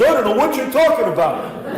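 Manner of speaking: Peter as a New Yorker. Retold or imitated. Priest voicing Peter to a listener, a laughing New York denial. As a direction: blurt it out like a nervous New Yorker. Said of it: "I've tended to imagine Peter".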